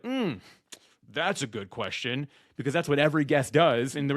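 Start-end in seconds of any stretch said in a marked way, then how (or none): 0.0-2.3 mock pondering. Unprepared guest asked for a final thought, mock pondering. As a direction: imitate someone buying time to think, drawn out.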